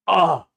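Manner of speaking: loud, high "ah" of delight; sudden loud outburst of admiration; delighted exclamation. Real, not acted. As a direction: burst out with a short, high cry. Exclaims over a favourite story.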